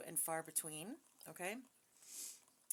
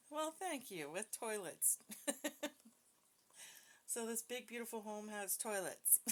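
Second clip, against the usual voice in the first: giggling; 4 semitones higher than usual. Real, not acted. Giggling at spirit box joke.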